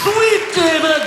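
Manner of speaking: high, loud, drawn-out cheer; hype shout. Real, not acted. Comic hypes the crowd for his friend, loud cheer. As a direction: whoop his name to the crowd. Hyped shout for David Spade.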